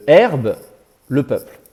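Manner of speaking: "herbe", a clear pause, then "le peuple"; separated by a pause. Shown as a demonstration. Teacher showing students subject and predicate, "herbe" and "le peuple" kept apart by a pause. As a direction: set "herbe" and "le peuple" apart as two units. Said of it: "il faut, euh, bien séparer les deux".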